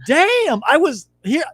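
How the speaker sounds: very high, drawn-out exclamation; mock outburst. Retelling a forbidden childhood curse, mock outburst. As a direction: exaggerate the curse for laughs.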